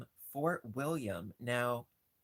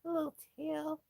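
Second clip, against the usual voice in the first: high squeaky coo; cutesy high voice. Real, not acted. Cooing over rat toy.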